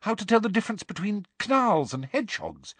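high-pitched earnest pleading; Hermione, earnest. A narrator's reading. Hermione urging Hagrid to pass inspection, earnestly. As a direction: press the case in a high, earnest voice. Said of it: "said Hermione earnestly".

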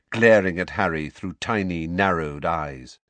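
ordinary delivery

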